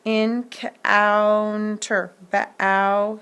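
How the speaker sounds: encounter drawn out very slowly, then ba-ow isolated; slow sounding out. Shown as a demonstration. Teacher isolating the vowel sound in a word. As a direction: stretch encounter and pull out the ow. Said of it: "So it says ow".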